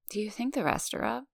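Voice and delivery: soft sleepy mumble; mumbling. A narrator's reading. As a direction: mumble it half asleep, softly. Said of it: "she mumbles".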